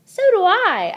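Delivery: high, loud earnest squeak; heartfelt squeak. A narrator's reading. Hamster answering Saya, a high earnest squeak. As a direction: squeak it and mean it. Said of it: "I squeaked".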